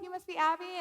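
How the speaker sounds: high, friendly doctor voice; breezy doctor. Retold or imitated. Doctor greeting his new patient, high and breezy. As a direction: greet the patient on a lifted pitch. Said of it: "He comes in, he's like".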